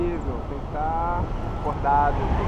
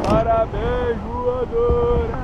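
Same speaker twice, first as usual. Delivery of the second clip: drawn out; 6 semitones higher, 5 dB louder, much slower than usual. Real, not acted. Repeated drawn-out congratulation.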